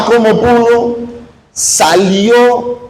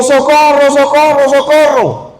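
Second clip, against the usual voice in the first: retold shouting; 6 semitones higher than usual. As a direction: shout for help toward the beach. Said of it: "iba gritando durísimo".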